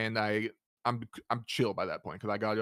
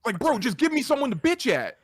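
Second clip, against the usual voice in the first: loud, high exasperated complaint; exasperated shout; 12 semitones higher, 7 dB louder than usual. Retold or imitated. Customer venting at the stonewalling clerk, loud and high. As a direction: yell it, fed up with being stonewalled. Retelling the heated store argument.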